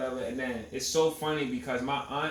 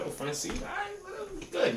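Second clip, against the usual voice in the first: high fake-casual voice; mock casual. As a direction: act unbothered in a high voice. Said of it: "I'm like".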